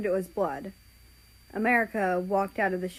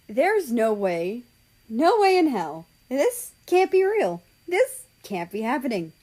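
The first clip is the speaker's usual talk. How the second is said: loud, high, swooping denial; shocked disbelief; 4 semitones higher, 7 dB louder than usual. Acted. America sees the fallen mother, loud shocked disbelief. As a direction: cry out in disbelief, pitch leaping.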